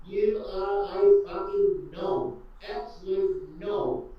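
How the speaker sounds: slow insult. Real, not acted. Insulting Beasley slowly.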